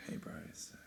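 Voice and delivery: whispered imitation of a quiet phone voice; very quiet talker. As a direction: mimic someone barely audible on the line. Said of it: "talks really quietly".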